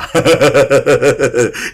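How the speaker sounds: low rolling chuckle; drawn-out laugh at your own joke; chuckling. Real, not acted. Preacher laughs at his own Javanese wordplay, chuckling.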